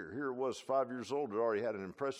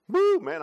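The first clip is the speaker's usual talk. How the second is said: mock sob; 12 semitones higher, 14 dB louder than usual.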